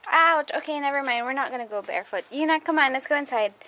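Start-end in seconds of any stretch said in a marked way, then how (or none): 0.1-0.5 loud ouch. Real, not acted. Stepping barefoot on frozen ground.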